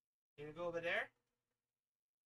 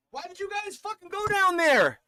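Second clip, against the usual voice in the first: loud exasperated yell; 15 dB louder than usual. Real, not acted. Yelling at the cats for going down there, exasperated.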